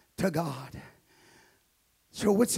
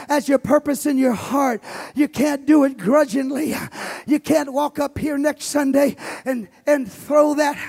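loud, driving preacher cadence; preaching at full force; 9 semitones higher, 12 dB louder than usual. Acted. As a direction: pastor preaching to his congregation on giving, full force. Preaches about giving at full force.

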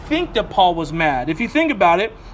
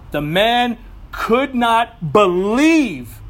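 ordinary talk, nothing marked

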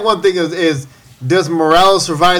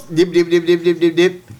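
Porky Pig stutter. Retold or imitated. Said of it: "Porky Pig noises".

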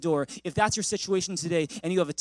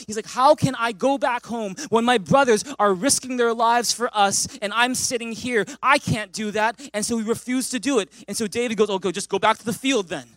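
loud, driving sermon voice; rising preacher's fervor; preaching at full force; 4 semitones higher, 6 dB louder than usual. Acted.

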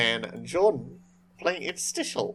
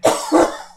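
harsh choking cough; choking on a drink; 10 dB louder than usual. Real, not acted. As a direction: cough hard after a drink goes down wrong. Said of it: "Oh god, [name] is choking".

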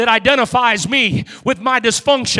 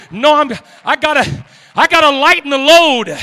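ordinary talk, nothing marked

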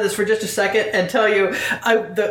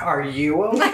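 lower, laughing imitation of a concerned friend; retold concerned friend; 4 semitones lower than usual. Zeke checking on the squirming narrator, imitated concern. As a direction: lean on the question, lower, mock-solicitous. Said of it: "Zeke reached over, put his hand on my arm".

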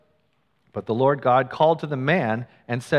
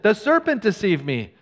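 higher, louder pleading excuse; whiny blame; 8 semitones higher, 4 dB louder than usual. Acted. Woman blaming the serpent before God, whiny. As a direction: point the finger, pleading.

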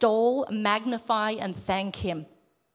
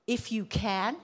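"If you can" turned into a question; questioning rise; slower than usual. Retold or imitated. Jesus querying the father's words, questioning rise on "can". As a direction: lift "can" at the end, as if querying it. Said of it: "It's a question".

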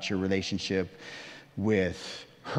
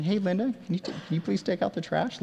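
gentle request; 7 semitones higher, faster than usual. Retold or imitated. Pastor showing how he means the request to Linda. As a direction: ask sweetly, as he means it inside. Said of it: "I'm saying".

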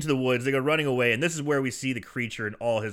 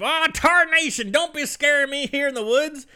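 much higher, startled hillbilly; 12 semitones higher, 4 dB louder than usual. Retold or imitated. Uncle Dave voiced in story.

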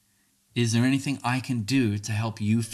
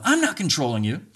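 higher, defensive retort; 5 semitones higher, 4 dB louder than usual. Acted. Speaker gives the reply to avoid, defensively. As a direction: push back against the accusation. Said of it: "That's just defense to match the offense".

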